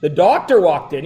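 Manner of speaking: ordinary talk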